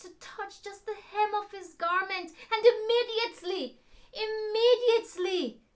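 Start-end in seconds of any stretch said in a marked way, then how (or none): 2.5-5.6 higher and louder, building wonder; excited. A narrator's reading. Telling children of the miracle, excited and loud. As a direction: burst out with the miracle. Moment of healing in story.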